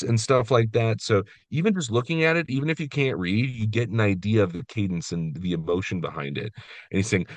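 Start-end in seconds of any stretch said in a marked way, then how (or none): none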